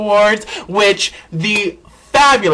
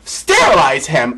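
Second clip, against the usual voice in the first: ordinary talk, nothing marked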